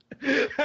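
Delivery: short high burst of laughter. Real, not acted. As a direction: laugh at your own joke. Laughing at own joke.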